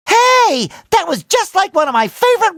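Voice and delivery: high, bursting exclamation; excited recognition. Acted. Recognizing a favorite role-playing game, excited high cry. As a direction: blurt it out high in delight.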